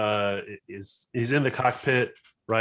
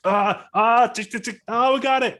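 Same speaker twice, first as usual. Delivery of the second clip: high frantic yelps and ticks; scrambling pilot; 13 semitones higher, 6 dB louder than usual. Acted. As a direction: panic at the switches, then exult. Voices pilot scrambling before jump.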